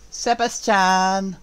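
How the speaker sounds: slow, high, drawn-out butler voice; creepy butler. Retold or imitated. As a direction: stretch the name like a sinister servant. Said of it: "The butler's called Sebastian".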